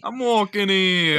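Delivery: slow exaggerated Boston street accent. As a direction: drag out "walking" like an angry pedestrian.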